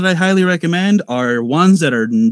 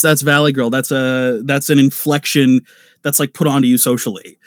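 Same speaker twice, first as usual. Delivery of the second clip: normal talking voice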